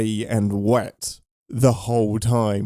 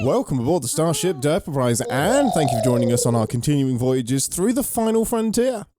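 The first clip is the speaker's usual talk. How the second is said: grand, higher starship captain announcement; announcer intro; 5 semitones higher than usual. Acted. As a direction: open the show like a captain's log.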